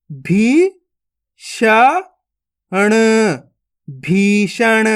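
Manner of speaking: each syllable spaced out, then the word blended slowly; syllable-by-syllable reading. Shown as a demonstration. Teacher to young students: slow sounding-out of each syllable.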